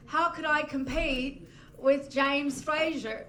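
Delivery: slow, badly faked Australian accent. Dating match messaging her, in a shaky-at-best Australian accent. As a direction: attempt a drawn-out Australian accent. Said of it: "He goes in his Australian accent".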